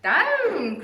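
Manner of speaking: high loud cry of down; a witch shouting her broom down. Acted. Witch yelling at her broom to land, high and loud. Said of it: "cried the witch".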